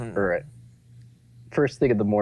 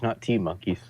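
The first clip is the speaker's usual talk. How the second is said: ordinary delivery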